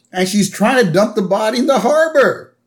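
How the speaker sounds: high, put-on cartoon bystander accusation; hammed-up alarm with swooping pitch; Lenny. Townsman telling the crowd about the body, high mock alarm. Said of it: "And Lenny is like".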